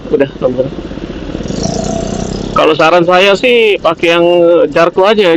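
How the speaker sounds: ordinary talk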